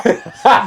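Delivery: bursting, high laugh; loud laugh. Real, not acted. Cohost cracks up at the story, laughing loudly. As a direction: crack up at the punchline.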